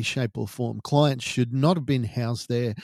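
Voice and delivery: ordinary talk, nothing marked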